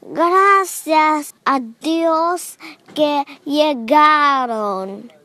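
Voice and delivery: slow, loud relieved shout. A narrator's reading. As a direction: call out in relief, slowly. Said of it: "gritó el cocinero".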